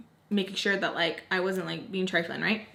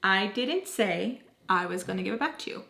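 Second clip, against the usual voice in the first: deliberate, word-by-word retold reply; 4 dB quieter than usual. Same reply repeated slowly after "What?.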